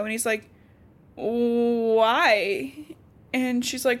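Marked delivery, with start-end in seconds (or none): 1.1-2.7 long, drawn-out baffled question; mock disbelief. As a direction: stretch the word out in put-on dismay. Acts out Sam's baffled reply.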